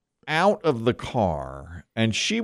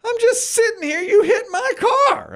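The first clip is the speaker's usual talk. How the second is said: quoting her, pitched way up; high indignant retort, slowing down; woman's retort, high. Retold or imitated. Retelling the woman insisting he hit her, high. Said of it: "And she goes".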